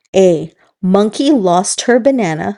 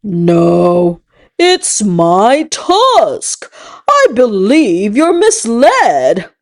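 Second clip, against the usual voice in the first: slow elephant voice; much slower than usual. Acted. Elephant answers monkey.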